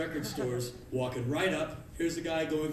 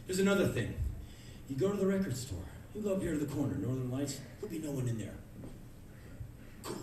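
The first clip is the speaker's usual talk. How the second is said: usual speaking voice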